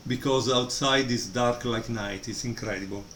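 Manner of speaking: ordinary talk, nothing marked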